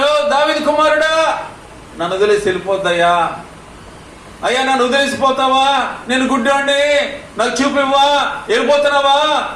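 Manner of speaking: high, pleading cry to Jesus; blind man crying out. Retold or imitated. Blind man pleading with Jesus not to pass him, high cry. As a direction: beg desperately not to be left. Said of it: "పాపం ఆ గుడ్డైన కేకలు".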